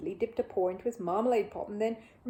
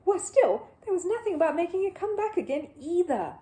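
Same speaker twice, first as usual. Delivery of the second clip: higher, louder rising alarm; alarmed narration; 9 semitones higher, 6 dB louder than usual. A narrator's reading. Narrator voicing Paddington's panic, high and loud. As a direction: panic with the bear.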